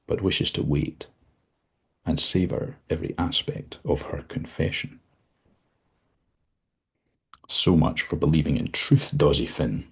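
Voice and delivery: ordinary delivery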